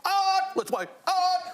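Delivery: mock the fans' singsong; mimicking fans. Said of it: "when people say".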